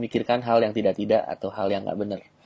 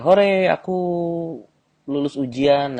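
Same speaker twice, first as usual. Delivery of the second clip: girl celebrating passing her exam, mock cheer; 6 semitones higher, slower than usual. Shown as a demonstration. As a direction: cheer as if you just passed. Girl cheering she passed.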